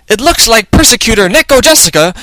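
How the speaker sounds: high-pitched character voice; Maya. A narrator's reading. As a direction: voice the sidekick up high. Narrator voicing Maya.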